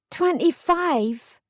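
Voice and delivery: slow, high, stunned echo; amazed question. Acted. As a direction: drag it out in disbelief. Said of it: "I was amazed".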